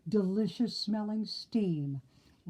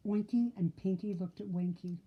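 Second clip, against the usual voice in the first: usual speaking voice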